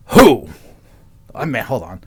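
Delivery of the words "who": loud, abrupt biker "Who?"; biker's question. Acted. Biker asking which friend they mean, loud and hammed-up. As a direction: fire it out as a biker. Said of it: "or gruff voices".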